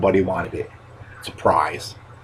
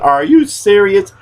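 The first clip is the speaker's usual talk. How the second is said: stunned disbelief; 9 semitones higher, 4 dB louder, slower than usual. Retold or imitated. Told he got most votes.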